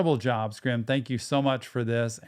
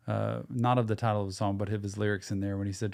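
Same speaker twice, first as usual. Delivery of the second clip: usual speaking voice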